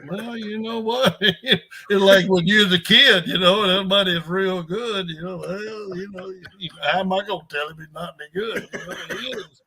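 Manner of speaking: high-pitched, laughter creeping into the words; tickled, chuckle through your own dilemma; amused, laughing. Real, not acted. Coach wondering how to answer a star player, laughing.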